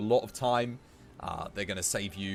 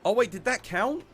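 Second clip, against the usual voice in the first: 1 alarmed surprise; 10 semitones higher, 7 dB louder than usual. Real, not acted. Sudden panic over wrong quest.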